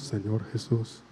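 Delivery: whisper-soft response; hushed echo. Shown as a demonstration. Priest repeats the line as the girl's quiet answer. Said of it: "Otra vez, vamos".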